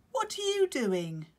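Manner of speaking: high, loud grumbling complaint; complaining penguin. Acted. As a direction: grumble it at the chick. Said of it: "Claudius complained".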